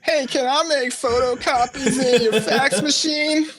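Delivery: very high, slow cartoonish voice; Tutu. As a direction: play an eager, clueless job applicant in falsetto. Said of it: "talking like that".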